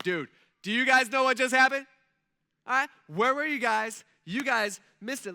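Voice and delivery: loud, high, hyped-up dude; pumped angel. Pretend. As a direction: play an angel bursting with news. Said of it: "this angel's probably pretty pumped".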